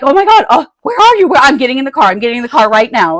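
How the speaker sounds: loud, high, frantic outburst; retold panic. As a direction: act out rushing to the rescue in alarm. Acting out own panicked reply.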